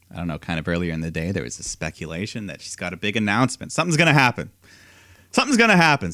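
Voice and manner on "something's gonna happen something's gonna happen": rising excited repeat, second higher; hyped teasing. Retold or imitated. Podcast host teasing a rumoured announcement, excited. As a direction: build the hype on the repeat.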